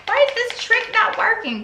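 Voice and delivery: high, loud panicked cry; frantic high yell at the website; freaking out. Retold or imitated. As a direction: panic at the screen. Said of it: "So I'm freaking out".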